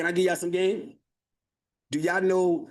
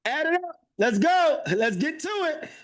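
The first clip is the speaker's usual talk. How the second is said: high, pumped-up rally; excited hype; 9 semitones higher than usual. Real, not acted. Host hyping the chat to do the math, excited. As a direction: fire the crowd up to add it up. Hyping the audience over numbers.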